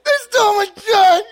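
loud, high hammed-up wail; imitated crying. Son phoning mom about stolen truck, mock wailing. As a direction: bawl it down the phone like a kid. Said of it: "But I'm crying".

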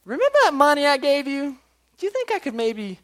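high-pitched, put-on tentative asking; mock polite request. Creditor asks a debtor for money back, mock polite. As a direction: play an overly nice creditor up high. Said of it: "it's not like he walks up to this guy and says".

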